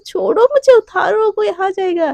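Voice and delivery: high and loud, dropping toward the end; high squealing protest. Acted. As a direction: squeal to be let go, then worry someone's coming. Struggling out of Radhika's lap. (ऊँची चीख़ती हुई मनाही)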